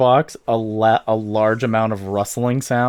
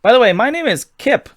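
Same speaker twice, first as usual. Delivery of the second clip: high-pitched scientist voice; 11 semitones higher, 3 dB louder than usual. Acted. Kip introduces himself.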